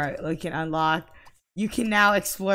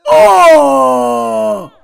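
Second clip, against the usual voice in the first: sudden high loud cry; startled shout; 7 semitones higher, 17 dB louder than usual. Real, not acted. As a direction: yelp in shock. Startled outburst at game.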